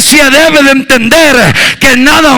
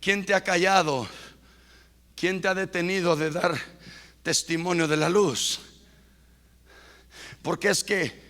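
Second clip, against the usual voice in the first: low, soft, confiding; hushed preaching; 6 semitones lower, 17 dB quieter than usual. Acted. Preacher quietly challenges the church. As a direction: challenge them in a near murmur.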